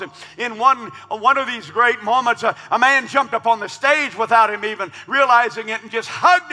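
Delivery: loud, high rising storyteller preaching; preaching story at full force. Acted. Preacher telling the congregation about the applause, loud. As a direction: lift the crowd with the tale.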